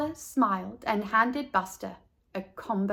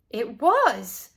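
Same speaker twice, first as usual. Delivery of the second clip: in horror; 4 dB louder than usual. A narrator's reading. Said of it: "asked Natey in horror".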